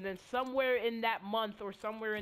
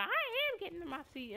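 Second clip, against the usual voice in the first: sassy backtalk; 9 semitones higher than usual. Retold or imitated.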